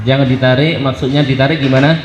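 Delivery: normal talking voice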